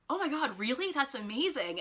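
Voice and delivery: retold, earnest excited reaction; sincerely impressed. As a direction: imitate someone truly thrilled by the news. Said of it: "And not kind of like".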